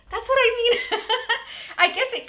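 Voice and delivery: high, loud laughing talk. Real, not acted. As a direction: laugh through the words at yourself. Laughs at own mistake.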